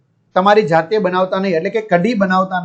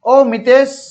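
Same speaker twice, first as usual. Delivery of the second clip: Mr Parikh calling out; 6 semitones higher, 4 dB louder, slower than usual. Acted. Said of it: "mister Parikh".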